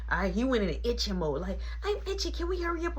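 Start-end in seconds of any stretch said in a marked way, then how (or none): 1.8-3.0 put-on high, whiny little-kid voice; high-pitched mock whine; complaining kid. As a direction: imitate an itchy child nagging.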